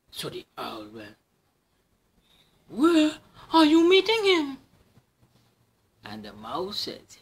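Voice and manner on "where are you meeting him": high, loud owl question. Acted. Owl asks the mouse where. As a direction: hoot the question.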